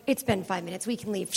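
low, very soft plotting voice; hushed, conspiratorial kid aside; furtive undertone. Retold or imitated. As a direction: plot an escape without waking the teacher. Kids plotting beside sleeping teacher.

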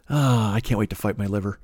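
ordinary delivery

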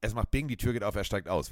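ordinary delivery

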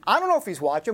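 normal talking voice

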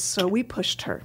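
low, soft deadpan; deadpan punchline. Shown as a demonstration. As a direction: drop the punchline flat and quiet. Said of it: "That's the bit".

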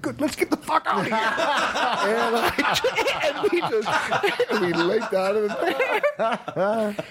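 high, breaking into laughter; laughing hard. Real, not acted. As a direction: laugh through every word. Cracking up at his own story.

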